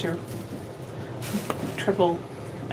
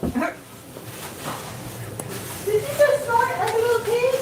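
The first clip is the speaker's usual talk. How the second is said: cute high voice; 8 semitones higher, 6 dB quieter than usual. Real, not acted. Teasing partner about a snort.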